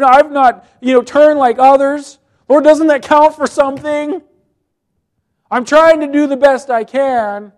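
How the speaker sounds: high whining plea for credit; pleading whine. Acted. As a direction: beg God like a sulky king. Hezekiah's whiny prayer acted out.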